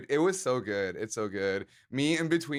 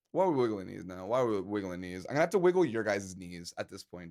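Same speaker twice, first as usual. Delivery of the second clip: ordinary talk, nothing marked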